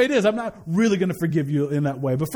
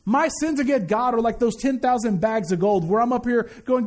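normal talking voice

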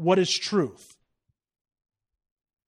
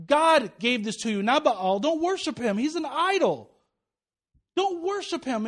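lightly voiced pleading prophet, pitched up; higher, urgent retold warning against idols; Hosea; 6 semitones higher than usual. Prophet warning the people off Baal, lightly acted urgency. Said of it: "where Hosea is going".

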